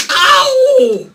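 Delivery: high, very loud "ow" cry; mock pain yelp. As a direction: yelp as if hurt. Balloon mishap while blowing up.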